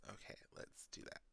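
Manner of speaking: breathy whisper to herself. Real, not acted. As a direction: whisper under your breath. Aside while app loads.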